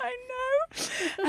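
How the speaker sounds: high, drawn-out sing-song; mock-proud squeal; playful self-congratulation. Real, not acted. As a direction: lap up the compliment in a high voice. Playful reply to being called current.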